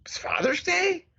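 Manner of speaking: high, slow startled whisper. Retold or imitated. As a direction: whisper it in disbelief. Surprised whisper, retold.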